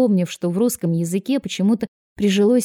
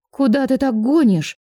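higher, startled question; alarmed gasp; 6 semitones higher, 3 dB louder than usual. A narrator's reading. Passenger reacts to Stas speeding off, alarmed gasp. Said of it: "ахнула она".

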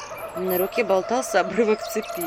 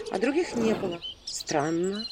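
plain reading voice

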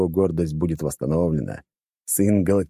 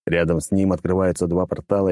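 ordinary delivery

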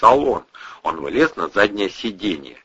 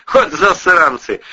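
amused; 8 semitones higher, 5 dB louder than usual. Acted. Returns smiling, amused.

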